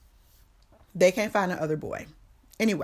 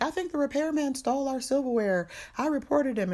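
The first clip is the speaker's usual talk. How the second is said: retold wife; 8 semitones higher than usual. Retold mom reporting to dad.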